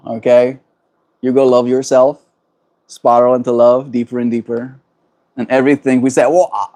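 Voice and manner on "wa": British accent. Shown as a demonstration. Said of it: "that's the, the British one".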